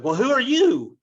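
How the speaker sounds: outraged squawk of a retort, fired back at Paris; indignant echo. Retold or imitated. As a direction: Romeo mock-outraged, hurling the same question back at Paris. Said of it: "Romeo's like".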